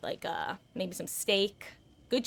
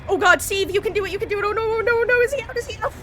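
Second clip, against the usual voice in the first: panicked; 12 semitones higher, 8 dB louder, faster than usual. Real, not acted. Streamer chased in horror game.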